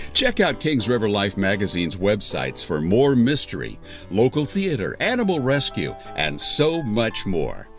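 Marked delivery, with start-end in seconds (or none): none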